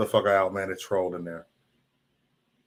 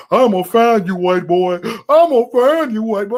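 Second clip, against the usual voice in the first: higher, louder mock threat; mock keyboard warrior; 7 semitones higher, 7 dB louder than usual. Mocking a chat troll's threat, higher and louder. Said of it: "the keyboard warrior shit".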